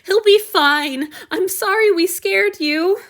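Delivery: high, loud, excited outburst; joyful youth. Acted. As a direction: spill the good news in a rush of joy. Han Yu joyfully telling news.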